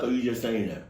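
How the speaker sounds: ordinary delivery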